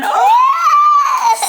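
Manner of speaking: very high, loud squealed "oh"; high delighted squeal. Real, not acted. Mother reacting to her little one's outfit, delighted squeal.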